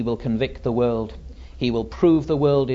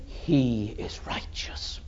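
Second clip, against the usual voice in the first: soft, solemn pronouncement, almost whispered; hushed verdict; 7 dB quieter, slower than usual. Acted. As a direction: breathe it out with quiet awe. Father's verdict after the climax.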